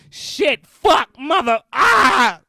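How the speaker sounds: high, loud burst of swearing into a yell; hammed-up cussing outburst; explosive cussing. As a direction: shout each curse, ending in a long roar. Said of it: "just start cussing".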